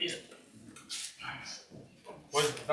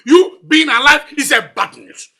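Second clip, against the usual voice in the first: loud, raised-pitch contempt; angry insult; 6 semitones higher, 19 dB louder than usual. Acted. Father cursing the one who greeted him, angry shouting.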